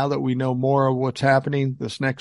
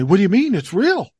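indignant scammer; 7 semitones higher, 4 dB louder than usual. Retold or imitated. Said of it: "they say".

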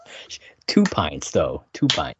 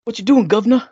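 high-pitched cheeky English accent; mock English accent; 12 semitones higher, 4 dB louder, faster than usual. Shown as a demonstration. Co-host tries more of the praised accent, hammy English. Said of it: "Your accent is very good".

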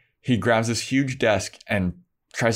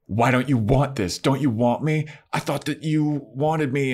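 rising, hurt anger climbing higher; pissed off; 5 semitones higher than usual. Acted. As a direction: accuse him, getting more upset with each question. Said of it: "she's pissed now at Xander".

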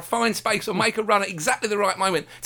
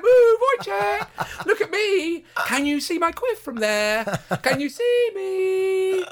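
high, slow, camp squeal; shrill camp pantomime dame; 13 semitones higher, much slower than usual. Retold or imitated. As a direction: shriek it like a preening panto dame. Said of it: "I imagine that's how Moroccan people speak".

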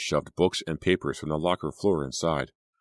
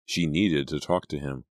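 usual speaking voice

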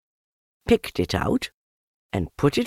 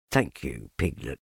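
very low, subdued donkey; Eeyore, glum; 9 semitones lower, 6 dB quieter than usual. A narrator's reading. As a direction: thank them low and softly, let down. Eeyore thanks Piglet glumly.

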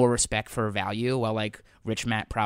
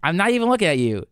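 loud protest; 7 semitones higher, 6 dB louder than usual. Real, not acted. Repeat, louder protest.

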